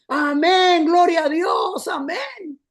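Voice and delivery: mock ecstatic crowd shouting gloria; congregation crying amen. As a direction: impersonate a church swept up in emotion. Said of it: "se oye la voz de la iglesia".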